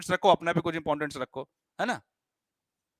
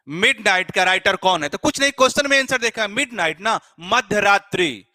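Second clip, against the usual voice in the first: much louder, pressing the question on the class; loud teaching voice; 4 semitones higher, 9 dB louder than usual. Real, not acted. Teacher stresses the answer to online students, loud. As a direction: boom out the keyword for the whole class.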